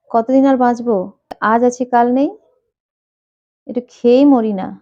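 lightly voiced stubborn father, raising his voice; defiant insistence. Father brushing off health warning.

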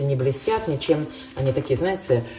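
ordinary talk

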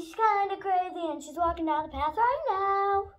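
usual speaking voice

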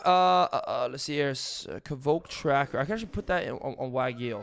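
usual speaking voice

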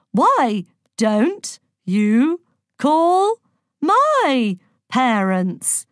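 loud, very slow, word-by-word delivery; stilted, high and hammy; spectacularly bad acting. A narrator's reading. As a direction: declaim it like a hopeless amateur actor. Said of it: "loudly with spectacularly bad acting skills".

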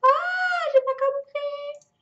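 very high, drawn-out squeal; high baffled wail. Real, not acted. Player lost after a sudden failure, squealing wail. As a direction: squeal slowly in bewilderment.

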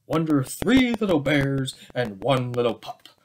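usual speaking voice

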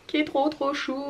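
high, soft adoring coo; cutesy cooing. Real, not acted. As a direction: gush softly over a cute outfit. Gushing over cute baby outfit.

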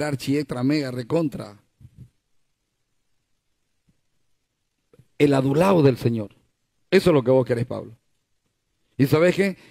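preacher voicing God telling Paul he could swell with pride; voice of God answering Paul. God answering Paul's prayer.

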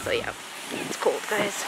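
whispering in a crowded store; hushed so shoppers won't stare; library whisper. Real, not acted. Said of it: "I'm whispering 'cause people are like".

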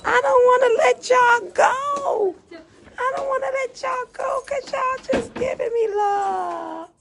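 high and loud, voice breaking with real tears; crying. Real, not acted. Singer begging to stay with her loving viewers, sobbing. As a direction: plead through sobs, not wanting to let go. Crying while reading fans' love.

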